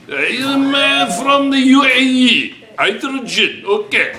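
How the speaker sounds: hammed-up Uzbek-style accent in class; mock foreign accent. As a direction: playfully fake an Uzbek-sounding accent. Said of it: "You sound like Russian".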